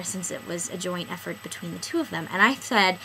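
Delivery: usual speaking voice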